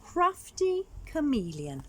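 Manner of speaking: ordinary delivery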